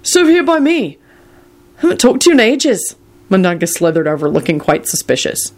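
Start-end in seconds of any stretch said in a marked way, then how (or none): 0.0-2.9 high, chummy invitation; friendly call. A narrator's reading. Neville inviting Mundungus to sit, friendly. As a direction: welcome an old pal over. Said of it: "Neville called out in a friendly voice".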